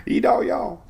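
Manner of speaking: high, laughing twang on E Dahl, y'all. Pretend. Still giggling at the storm name, high twang. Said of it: "That's a redneck name".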